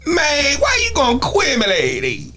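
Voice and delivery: high-pitched mumbled hip hop imitation; mock a rapper slurring words together way up high; exaggerated garbled rap voice; garbled rapper impression. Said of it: "Where do they talk like this".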